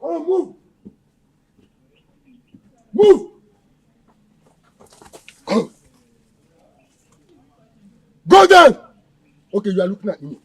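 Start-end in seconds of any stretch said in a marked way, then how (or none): 0.0-8.8 barked orders. Acted. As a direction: yell at the captive. Kidnapper orders the captive.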